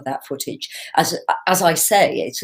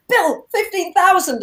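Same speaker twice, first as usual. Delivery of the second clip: excited; 11 semitones higher than usual. Retold or imitated. Texting Bill about huge crowd.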